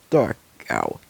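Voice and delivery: plain reading voice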